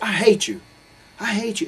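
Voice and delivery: loud, high-pitched screaming hate; betrayed friend screaming on the phone; retold furious yell. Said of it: "so upset and moved by the whole incident".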